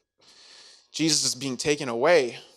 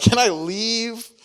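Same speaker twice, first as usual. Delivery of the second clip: higher whiny plea; 8 semitones higher, 7 dB louder, slower than usual. Acted. Acted awkward kid pleading.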